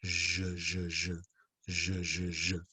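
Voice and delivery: "je" as a quiet, low soft zh; hushed drill of "je", soft French J. Shown as a demonstration. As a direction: repeat "je" softly, with no hard d before the zh. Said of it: "it's a really soft je".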